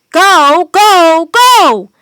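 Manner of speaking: high, loud repeated shouts; rising shouts to scare them; shouting. A narrator's reading. Jack shouting down the wolves, loud and rising. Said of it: "shouted Jack".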